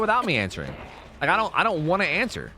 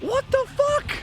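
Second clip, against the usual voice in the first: stunned shouting; 14 semitones higher than usual. Real, not acted. Streamer to chat over brutal zombies, high shocked yells. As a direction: shout in total disbelief at the screen.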